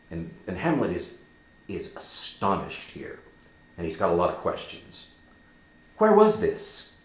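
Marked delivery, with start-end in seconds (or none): 6.0-6.9 high, sharp and urgent; urgent question. Acted. Hamlet pressing Horatio for details, high and urgent. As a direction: demand the place.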